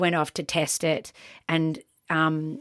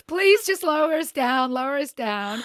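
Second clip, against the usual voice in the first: much higher, frantic begging; high pleading protest; 7 semitones higher, 3 dB louder than usual. Retold or imitated. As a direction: plead high and breathless not to be hauled up. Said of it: "I'm like".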